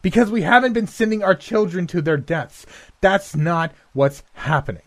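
high shout right in her face. Acted. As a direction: bellow the denial at her. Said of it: "shouted in her face".

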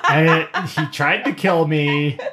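ordinary talk

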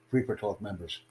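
normal talking voice

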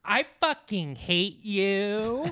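high, drawn-out put-on voice; imitating the cat. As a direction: stretch each word out like the talking cat. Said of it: "your wife comes home and you're like".

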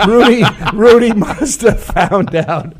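laughing through the words. Real, not acted. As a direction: crack up while saying it. Laughing at own joke.

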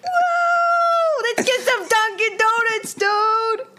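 hammed-up hyper Boston kid whooping about Dunkin'; Boston kid self-impression. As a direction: bounce around like a hyper five-year-old from Boston, laughing and yelling. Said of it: "do an impression of yourself".

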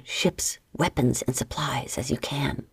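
soft whispered orders; pirate whispering his plans. Acted. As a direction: drop to a secret whisper. Plotting whispered aside.

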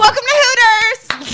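high, loud, drawn-out shout; mock waitress greeting. Pregnant waitress greeting customers, mock high loud shout. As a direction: holler it like a hammy server.

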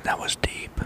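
hushed breathy whisper. Real, not acted. Whispered joke.

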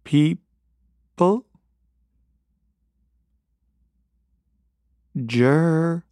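very slow, drawn-out words with long gaps; slow Thich Nhat Hanh. Retold or imitated. Comedian imitates the monk's talk, very slow. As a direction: imitate the monk, stretching each word. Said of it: "he talked like this".

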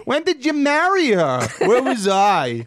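mock outrage. Real, not acted. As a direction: act hurt you missed the wedding. Laughing mock outrage at marriage.

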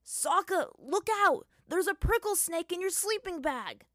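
high, loud fake panic shout; fake alarm. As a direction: cry out in pretend alarm. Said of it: "Pretending to be alarmed".

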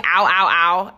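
mock yelp of pain, ow ow ow; pained ow cries. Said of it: "I'm just going".

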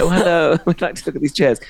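match her poshness, half mocking; mock polite reply. Customer answering the posh shop lady, a lightly posh polite voice. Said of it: "I said".

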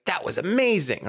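demonstrated gushing, pitch jumping high; mock-impressed, high-pitched exclamation; enthusiastic exclamation. Shown as a demonstration. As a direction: toss out a sample rave, swooping up then down. Example of own exclamation.

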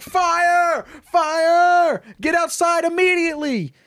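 high, loud yell of "Fire!"; shouting alarm. Acted. Jesse banging on the saloon door, yelling fire. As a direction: scream it to empty the building.

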